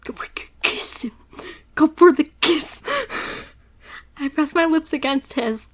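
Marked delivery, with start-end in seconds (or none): 0.0-1.1 soft breathless whisper; whispered choice. Real, not acted. Picking the kiss option, whispered to herself. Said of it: "Hold him or kiss him". 1.7-2.8 louder, higher firm call; decisive call. Real, not acted. Picking the kiss option, called out firmly. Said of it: "Hold him or kiss him".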